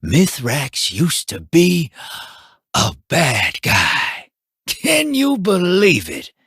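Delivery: ordinary talk, nothing marked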